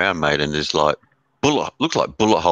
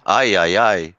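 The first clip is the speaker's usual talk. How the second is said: playful put-on Mexican "ay, ay, ay"; a hint of a Mexican accent; mock Mexican exclamation; 4 dB louder, slower than usual. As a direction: toss off a jokey Mexican cry. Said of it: "You wanna hear some Mexican".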